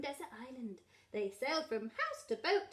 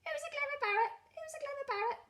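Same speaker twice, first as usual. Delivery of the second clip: very high parrot voice; parrot mimicry; faster than usual. A narrator's reading. Parrot recites instead of the shopping list, high squawky mimicry. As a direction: repeat it mechanically like a parrot.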